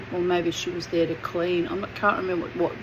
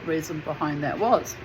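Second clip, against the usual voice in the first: ordinary talk, nothing marked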